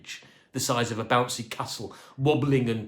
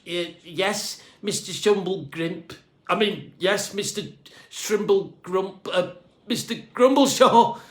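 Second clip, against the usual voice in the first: stammering, rising higher and louder with panic; nervous croak; 7 semitones higher, 6 dB louder than usual. A narrator's reading. Cleaner stammering at his boss, rising panic. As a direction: trip over the name in fright.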